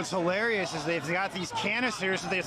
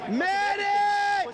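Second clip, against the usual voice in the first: man down shout; 12 semitones higher than usual. Real, not acted. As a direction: scream for a medic across the crowd. Calling for medic.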